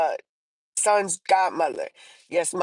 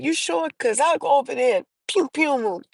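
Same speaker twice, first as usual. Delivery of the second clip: a hint of grandfather, pitched up; lightly voiced, higher-pitched questioning offer; imitated protective grandfather; 8 semitones higher than usual. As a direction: retell grandpa offering to step in.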